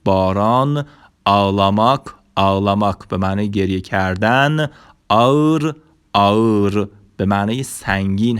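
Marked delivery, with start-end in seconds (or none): 1.2-2.0 "آلاماک" as ağlamak, first vowel lengthened; silent ğ demo. Shown as a demonstration. 5.1-5.7 "آور" higher, drawn out as aa-ır; Turkish ağır demo. Shown as a demonstration.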